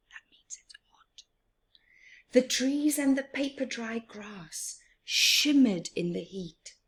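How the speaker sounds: ordinary delivery, nothing marked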